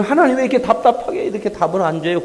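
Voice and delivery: high, loud, desperate plea; anguished plea to God. Retold or imitated. Believer crying out to God, high and loud. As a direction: cry out like a frustrated child.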